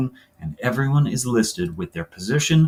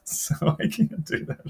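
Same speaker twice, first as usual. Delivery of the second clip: high, slow, laughing admission; real laughter running into the words; 5 semitones higher, 4 dB quieter, slower than usual. Real, not acted. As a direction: laugh as you give up on it. Laughing at own failed accent.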